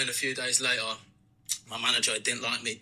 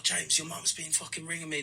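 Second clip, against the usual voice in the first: lightly voiced worried mum; 5 semitones higher, 3 dB quieter than usual. Mum waking her son's hungover friend, lightly imitated.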